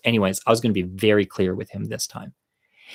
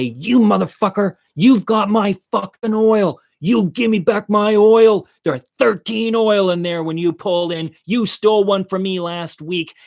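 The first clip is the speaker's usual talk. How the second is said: high, loud re-enacted tirade; pitched-up, indignant confrontation; retold angry yelling; 9 semitones higher, 3 dB louder than usual. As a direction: act out yelling an accusation at a thief. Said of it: "I'm yelling at them".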